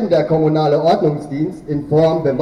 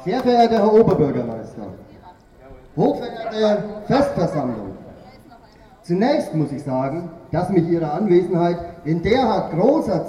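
pompous formal speech; 6 dB quieter than usual. Acted. Mock official delivers satirical Festrede.

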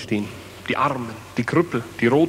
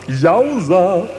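louder, higher happy cheer; excited cheer; 5 semitones higher, 6 dB louder than usual. Acted. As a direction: cheer it out to the crowd.

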